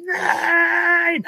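high anguished no; dramatic cry. Pretend. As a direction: wail no in mock despair. Imagined dramatic rescue of water.

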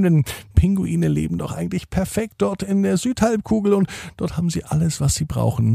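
ordinary delivery, nothing marked

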